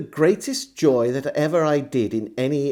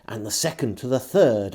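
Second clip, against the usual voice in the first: plain reading voice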